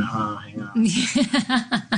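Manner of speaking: high, very slow, bewildered; flustered plea. Retold or imitated. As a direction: stall the fast talker, lost. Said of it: "they'll just start talking very fast".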